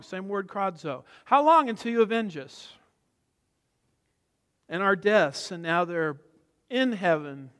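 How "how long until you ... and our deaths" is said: raised, pleading cry; imitated yell. Preacher voicing martyrs asking God for vengeance, imitated yell. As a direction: cry out for justice, half yelled. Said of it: "what they were yelling".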